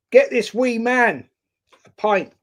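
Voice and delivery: a big Irish guy calling for a pint, a hint of brogue; Irish accent. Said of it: "I can't do an Irish accent".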